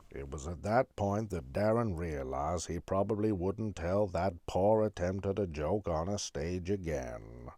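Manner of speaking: deep, deadpan voice-over; mock documentary narrator. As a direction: narrate your own failure in a low, measured voice. Mock narration of his own flop.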